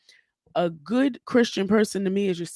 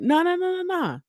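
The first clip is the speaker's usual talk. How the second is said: high incredulous no; 10 semitones higher than usual. Real, not acted. Rejecting the sister's excuse.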